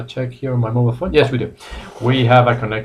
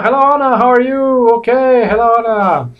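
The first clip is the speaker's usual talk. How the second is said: high, bright, louder hello; excited greeting; 9 semitones higher, 4 dB louder, faster than usual. Real, not acted. Teacher greeting a newly arrived student, excited and high. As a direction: greet her warmly and high.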